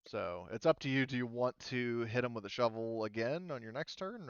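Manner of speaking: ordinary talk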